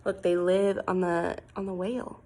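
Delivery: usual speaking voice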